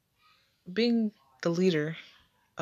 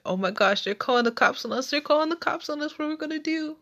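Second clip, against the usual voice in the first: put-on rushed, rising frightened child's cry, much higher; retold kid panic; 10 semitones higher, faster than usual. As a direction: blurt it out fast like a scared kid. Kids panic at the police threat.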